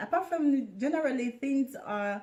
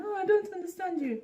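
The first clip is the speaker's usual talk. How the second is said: high mock quote; 9 semitones higher than usual. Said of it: "some of them".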